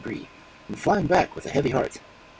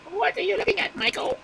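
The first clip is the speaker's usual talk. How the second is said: a much higher, curious bird voice; the magpie calling down from the bough. Acted. Magpie peering down at the fox, asking curiously.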